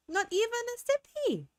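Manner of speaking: put-on high pouty little-kid whine; whiny baby voice. Streamer to chat, pouty baby whine over no juice. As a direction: whine it like a toddler denied a treat. Lamenting missed grape juice.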